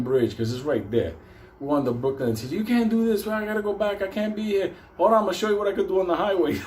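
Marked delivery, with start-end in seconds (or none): 2.5-4.7 high-pitched panicky protest; mock panic. Instructor protests on the Brooklyn Bridge, panicked. 5.0-6.5 fast, high, reckless boast; excited showing off. Retold or imitated. Driver to the instructor on the bridge, excited showing off.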